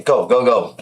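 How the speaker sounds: ordinary talk, nothing marked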